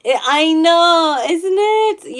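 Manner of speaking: high and loud, slow gush; delighted agreement with a viewer about the kitten; excited agreement. Real, not acted. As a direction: gush back to the chat in delight.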